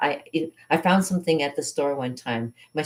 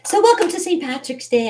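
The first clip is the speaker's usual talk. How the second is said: festive welcome; 12 semitones higher, 7 dB louder than usual. Real, not acted. Festive greeting to viewers.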